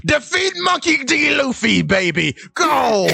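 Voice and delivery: high-pitched sports-dad urging; rousing cheer. Acted. Baseball dad sending his son to fight, hammed-up cheering. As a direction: egg the kid on, voice leaping up.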